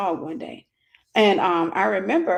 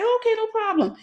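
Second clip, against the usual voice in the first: high mock friend voice; friend's easy reply; 12 semitones higher than usual. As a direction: lightly voice the friend accepting. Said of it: "And she was like".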